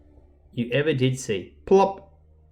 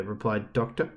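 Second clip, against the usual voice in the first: usual speaking voice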